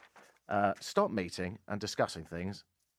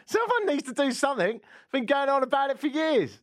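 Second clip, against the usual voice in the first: outraged voice; 5 dB louder than usual. Acted. Mock outraged caller voice.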